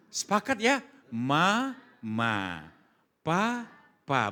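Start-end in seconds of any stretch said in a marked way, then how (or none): 1.1-4.3 gentle, drawn-out syllables; mother teaching baby. Acted. Mother teaching her child first words, gentle drawn-out tone. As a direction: coax a baby to copy each word. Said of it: "Betul ya begitu nadanya?".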